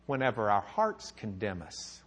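ordinary delivery

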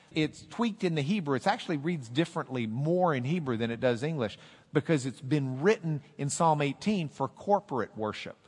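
usual speaking voice

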